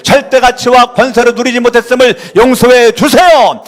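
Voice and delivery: shouted prayer, high and loud throughout; fervent loud prayer. Real, not acted. Pastor leading a shouted group prayer. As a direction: pour out each line as a fervent cry.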